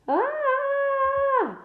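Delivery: sudden high shriek of terror; terrible scream. A narrator's reading. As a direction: shriek as if you've seen a monster. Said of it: "Malfoy let out a terrible scream".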